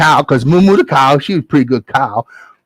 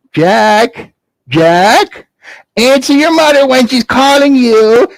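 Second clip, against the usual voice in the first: mama calling Jack; 10 semitones higher, slower than usual. Acted. Mother calling son home.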